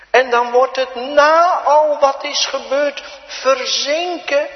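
loud, high scripture proclamation; fervent preaching. Acted. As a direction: proclaim the verse with urgency.

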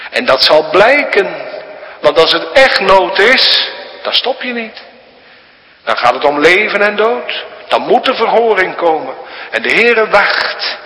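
high, loud, impassioned sermon; preaching at full force. Acted. Preacher crying out to the congregation, impassioned and loud. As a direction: thunder it from the pulpit.

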